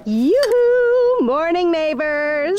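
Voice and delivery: high, bright sing-song greeting; peppy. Acted. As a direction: call out cheerfully, pitch way up. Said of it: "She is peppy".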